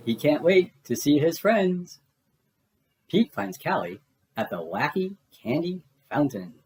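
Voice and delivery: ordinary delivery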